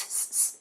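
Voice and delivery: unvoiced hissing s-; hissed s sound. Shown as a demonstration. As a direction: hiss the s before salami. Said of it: "I hope there's some s".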